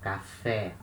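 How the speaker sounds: "café" ending in open "eh"; open accented E. Shown as a demonstration. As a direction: stress the last vowel of "café", opened wide. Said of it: "we have the sound of E".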